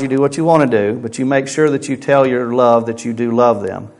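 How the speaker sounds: usual speaking voice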